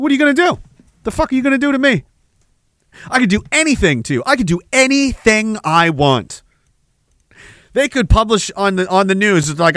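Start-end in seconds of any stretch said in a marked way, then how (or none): 0.0-6.4 higher, bullying taunt. Pretend. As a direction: gloat as an untouchable bully. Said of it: "taunt them in public".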